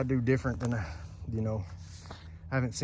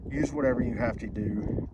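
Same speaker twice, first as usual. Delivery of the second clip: usual speaking voice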